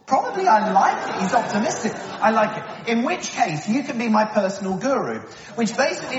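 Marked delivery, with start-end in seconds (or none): 0.1-0.5 amused echo. Real, not acted. Echoing the child's answer.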